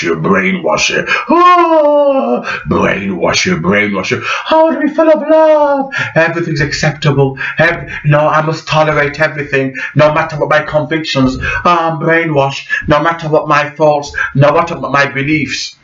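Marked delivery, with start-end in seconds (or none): 1.3-2.4 a squeaky high mocking "oh"; high mocking falsetto exclamation. Mocking a brainwashed citizen. 4.5-5.9 high, fast falsetto parody of a sappy believer; much higher mocking voice, rushed; mock brainwashed liberal. 6.2-15.8 higher, fast sing-song parody of a compliant believer; higher, quick mocking voice of someone brainwashed; mock brainwashed liberal.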